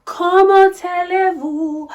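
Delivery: high, loud, hammed-up French; mock French diva greeting. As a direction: sing out a grand French hello.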